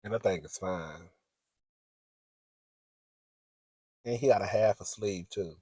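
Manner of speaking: low, soft murmur; hushed admiring aside. Real, not acted. Confiding about the man's looks, hushed aside. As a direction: keep the admiration under your breath.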